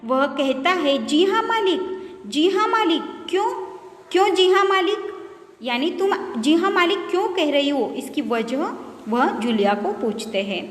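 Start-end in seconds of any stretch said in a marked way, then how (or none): 1.1-7.9 angry sputtering. Retold or imitated. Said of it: "गुस्से से तुतलाने लगता है".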